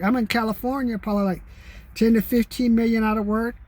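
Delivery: ordinary delivery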